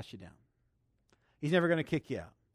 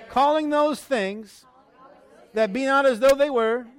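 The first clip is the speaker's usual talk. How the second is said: loud, high, drawn-out phrases for repeating; full-force preacher's call-and-repeat; leading prayer declaration; 7 semitones higher, 10 dB louder, slower than usual. Real, not acted. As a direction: proclaim each phrase for the congregation to echo. Leads congregation in repeat-after-me declaration.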